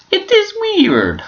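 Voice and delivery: very high, drawn-out put-on voice; squeaky silly voice. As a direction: pitch it right up and stretch it slowly. Voice jumps up, showing off voices.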